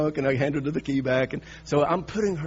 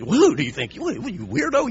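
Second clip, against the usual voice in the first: louder, higher mock scolding; a blast of imagined scorn from a stranger; imagined snap; 6 semitones higher, 6 dB louder than usual. A stranger imagined scolding him, mock angry.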